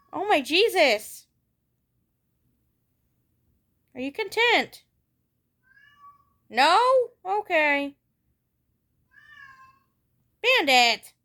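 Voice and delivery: high, loud calls to the cat; loud exasperated pet talk. Real, not acted. Telling off her noisy cat, loud exasperated calls. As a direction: scold the cat loudly, half amused.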